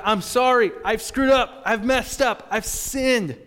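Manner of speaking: loud, high-pitched acted plea to God; impassioned confession. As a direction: cry out the admission at full preaching force.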